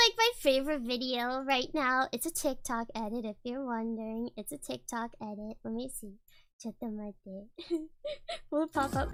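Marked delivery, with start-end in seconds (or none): none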